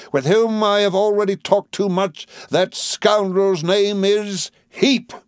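high, ringing denunciation; utmost vehemence. A narrator's reading. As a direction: break out in vehement accusation. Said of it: "suddenly breaking out again with the utmost vehemence".

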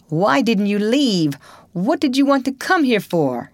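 high, loud accusing question; angry demand. A narrator's reading. Townsman accusing Potter, high and loud. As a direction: demand an answer from him. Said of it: "somebody said".

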